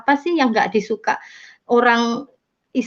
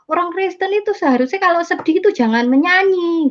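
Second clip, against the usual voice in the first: higher-pitched mimicry of critical relatives; imitated disapproval; 8 semitones higher than usual. Relatives objecting to Christians singing when sad, imitated disapproval.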